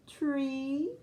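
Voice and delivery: normal talking voice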